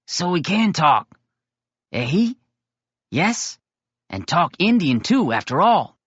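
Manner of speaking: a surprised friend who is thrilled he talks; excited boy Possum. Acted. Possum voiced in reading.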